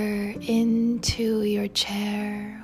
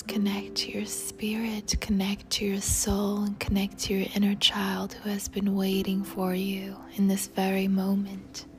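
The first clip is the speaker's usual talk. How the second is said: ordinary delivery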